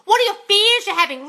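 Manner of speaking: ordinary talk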